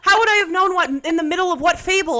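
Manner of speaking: high and loud, laughing at her own outrage; exasperated protest. Real, not acted. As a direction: argue it through half-laughs. Arguing the Bible makes no sense.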